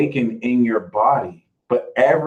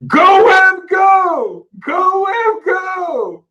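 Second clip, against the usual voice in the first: high, loud mock yell; imitated shouting; 4 dB louder, much slower than usual. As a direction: shout it like the boy in the video. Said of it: "saw this boy go like".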